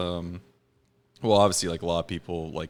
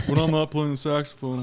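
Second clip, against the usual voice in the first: Bill Clinton voice; 6 semitones higher than usual. Pretend. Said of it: "I can't do a Bill".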